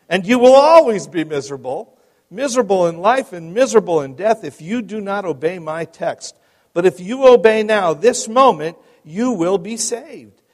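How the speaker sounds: loud, lifted pulpit warning; forceful lay preacher. Acted. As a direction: preach it straight at one listener, insistently.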